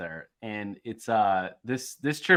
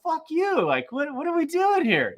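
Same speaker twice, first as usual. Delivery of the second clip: indignant outburst. Retold or imitated. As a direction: replay the rant in a high, incredulous voice. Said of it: "I go".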